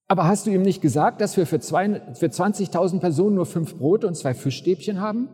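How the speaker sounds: schneller, höher, leicht genervt; ein bisschen gereizt. Acted. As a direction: ungeduldig nachbohren, unterzuckert. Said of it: "Die Kollegen klingen ein bisschen gereizt". (hungrige Kollegen fragen leicht gereizt nach)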